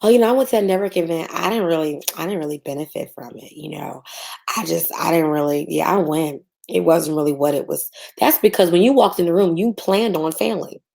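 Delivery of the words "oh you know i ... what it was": lower, deflated mock excuse; mimicking a networker who didn't benefit; mopey excuse. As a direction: shrug it off, lower and half-hearted. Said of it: "here's what y'all say".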